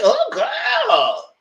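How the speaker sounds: put-on loud high excited girl voice; hyped. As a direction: gush like you're hype and happy. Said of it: "I said".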